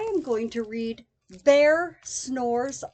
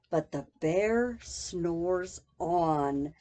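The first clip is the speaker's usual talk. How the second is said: slow drowsy refrain; 9 semitones lower, 3 dB quieter, slower than usual. A narrator's reading. Refrain read sleepily.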